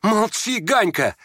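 put-on high boyish cry; shouting. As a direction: shout him silent in a high voice. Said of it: "крикнул опять Коля". (звонкий мальчишеский крик)

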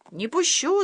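high, firm shout; dad shouting. A narrator's reading. Dad shouts, pulling mum out of the fridge. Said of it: "закричал папа".

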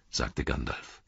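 ordinary delivery

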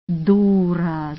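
usual speaking voice

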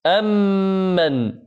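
slow, drawn-out chanted recitation with a long nasal hum; Quran recitation. Shown as a demonstration. Teacher recites the verse to the class, slow and melodic. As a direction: recite it slowly, holding the ghunna. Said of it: "يعني مثلًا".

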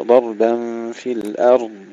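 slow "ضربا في الأرض", no echo after ر; still ra. Shown as a demonstration. Teacher repeats the correct reading, unbounced ra. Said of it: "نحافظ على سكون الراء بدون قلقلة".

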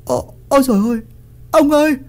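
very high cries of shock; high-pitched wifely panic; horrified shriek. A narrator's reading. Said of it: "rồi bà Đậu rú lên".

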